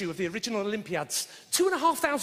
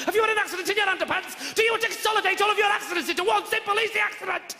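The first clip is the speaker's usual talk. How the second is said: hard-sell advert voice; 7 semitones higher than usual. Retold or imitated. Comic mocking late-night Sky adverts, high pushy ad voice. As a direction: fire questions like a pushy TV ad. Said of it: "hard sell ones on Sky".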